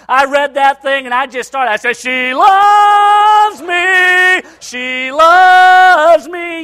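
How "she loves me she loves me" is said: long, high, belted-out cry of joy; hammed-up triumphant lovestruck yell; elated outburst. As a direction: holler it, stretching the words out. Acting his joyful outburst in snow.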